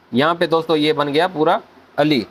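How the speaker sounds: usual speaking voice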